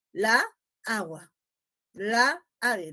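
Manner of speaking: la and agua said as separate words with a break between; la agua split. Shown as a demonstration. Teacher showing students the article said apart from the noun. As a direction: pause after la, then agua. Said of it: "Nosotros generalmente no hacemos esto".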